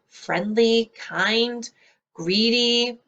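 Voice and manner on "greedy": normal talking voice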